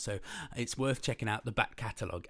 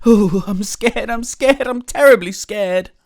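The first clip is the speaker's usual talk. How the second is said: high, loud fearful wail; repeated frightened cry; terribly scared; 10 semitones higher, 19 dB louder, slower than usual. Acted. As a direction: panic like a timid cook.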